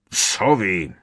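contained thunderous roar; thundering voice. A narrator's reading. Uncle outraged by an owl, moderately thundering roar. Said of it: "zaborácel strýc Vernon hromovým hlasem".

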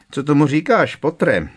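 loud taunt. A narrator's reading. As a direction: throw it at him loudly across the corridor. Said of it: "zeptal se Malfoy nahlas".

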